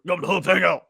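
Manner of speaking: gravelly Cajun coach, hyping it; Ed Orgeron promo voice. Retold or imitated. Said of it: "Only if Ed Orgeron can, can, like, do a promo for it".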